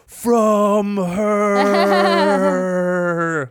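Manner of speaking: high, drawn-out mock slow-motion voice; slow-motion imitation. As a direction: stretch each word out like a film in slow motion. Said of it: "in real slow motion".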